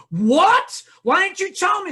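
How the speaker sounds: high, loud indignant mother; mock shocked outcry. Mother learning Kelly speaks Spanish, mock shocked outcry. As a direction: exclaim in hammed-up shock.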